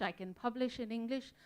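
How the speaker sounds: usual speaking voice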